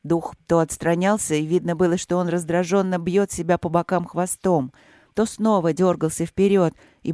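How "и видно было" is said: usual speaking voice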